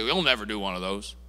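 low, soft, defeated voice; gloomy defeatist. Retold or imitated. Pastor mocking a defeatist money mindset, low and soft. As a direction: say it like all hope is gone. Contrasted negative confession quote.

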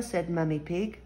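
ordinary delivery, nothing marked

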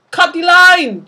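high, loud urgent shout; panicked shout to cut the fishing line. Acted. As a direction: yell the order over the water. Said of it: "shouted Nyssa".

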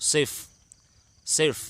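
ซิฟ as one syllable ending in f; model pronunciation. Shown as a demonstration. Teacher shows children Arabic zero, ซิฟ pronounced clearly. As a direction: say "ซิฟ" clearly, sounding the final f. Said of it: "ออกเสียงว่า".

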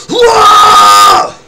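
huge high, loud scream; diaphragm scream. Shown as a demonstration. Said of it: "a diaphragm scream".

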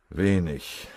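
ordinary delivery, nothing marked

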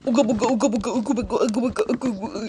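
low, chanted caveman uga buga; caveman grunts. Pretend. Mocking cavemen in BC year.

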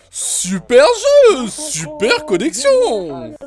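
slow, loud sarcastic drawl. Real, not acted. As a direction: stretch it out with sarcasm. Game kills him unfairly.

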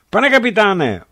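high, loud call. A narrator's reading. Signalmen call the captain about the contact, loud call. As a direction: shout for the captain. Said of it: "ozvalo se od signalistů".

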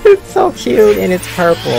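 high, loud, squealing delight; gushing. Real, not acted. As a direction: squeal over how cute it is. Gushing over a cute spell.

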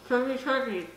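cheeks stuffed full. Real, not acted. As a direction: mouth full of marshmallows. Mouth filling with marshmallows.

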